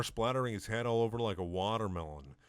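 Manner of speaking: ordinary talk, nothing marked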